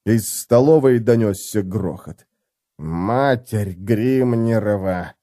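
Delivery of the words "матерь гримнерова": slow, drawn-out groan. A narrator's reading. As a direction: groan it in dismay. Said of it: "простонал Хамнер".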